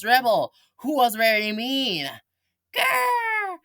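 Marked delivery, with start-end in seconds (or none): 2.7-3.6 high, slow evil growl; mock villain boast; hammed-up villain. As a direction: ham up the evil. Kids' comic villain voice.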